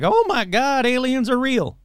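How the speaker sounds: high-pitched gullible gushing; mock amazement. Imitating everybody reacting to the alien news, high mock amazement. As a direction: gush in high, mock wonder.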